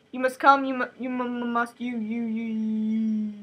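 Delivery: stuttering robot winding down to a stop; malfunctioning robot. Acted. Broken Miranda orders Tessa along, stuttering robot powering down. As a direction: glitch on syllables, then drone and fade. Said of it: "starts acting like a robot".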